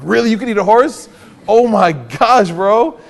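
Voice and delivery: loud, high, hammed-up astonishment; over-the-top incredulous reaction, stretched out; mock disbelief. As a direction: play shocked at an obvious exaggeration. Mocks taking exaggeration literally.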